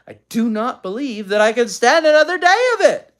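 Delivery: building to a high, loud finish; exasperated. A narrator's reading. Mother fed up with quarreling children, exasperated. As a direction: let the patience snap as it goes.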